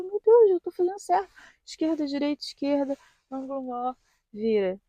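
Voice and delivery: high, tense nervous voice reciting "esquerda, direita"; anxious self-talk. Retold or imitated. Driver reassuring herself during the test, high and nervous. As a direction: chant the steps to herself in a rising panic. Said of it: "Eu ficava".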